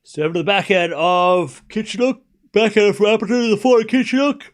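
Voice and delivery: high, loud tennis rally call; excited play-by-play. Real, not acted. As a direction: build to the winning point and break. Live play-by-play, break of serve.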